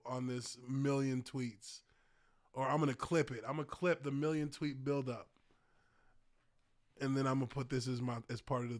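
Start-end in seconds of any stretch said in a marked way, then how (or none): none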